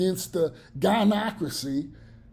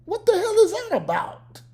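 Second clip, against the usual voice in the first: incredulous; 12 semitones higher, 3 dB louder than usual. Real, not acted. Incredulous high-pitched question.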